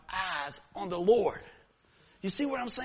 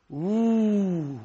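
crowd ooh; 7 dB louder than usual. Retold or imitated. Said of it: "everybody in that whole place go".

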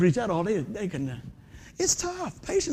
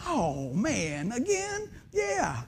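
swooping high, softer mock dismay; disappointed mother; 4 semitones higher, 5 dB quieter, much slower than usual. Preacher voicing his mother hearing the team is out, mock dismay. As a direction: act out Mama's let-down, pitch leaping up at the end.

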